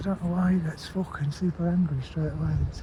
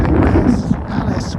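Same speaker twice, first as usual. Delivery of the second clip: horror movie voice; 4 semitones higher, 7 dB louder, much slower than usual. Retold or imitated. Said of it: "horror movies when they say".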